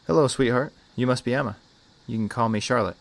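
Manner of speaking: put-on lifted, sing-out greeting to a child; friendly greeting. A narrator's reading. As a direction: greet a little girl sweetly.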